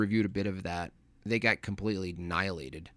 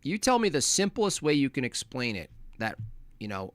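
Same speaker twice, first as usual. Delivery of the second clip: ordinary talk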